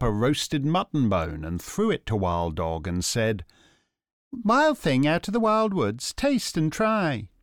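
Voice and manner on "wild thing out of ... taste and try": woman's voice. Acted. Woman offers bone.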